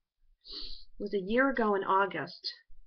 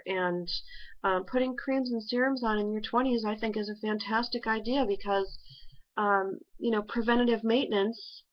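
face covered by damp mask; speaking under facial sheet; talking through sheet mask. Real, not acted.

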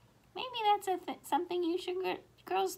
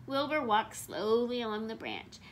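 ordinary delivery

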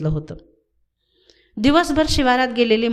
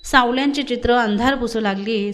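usual speaking voice

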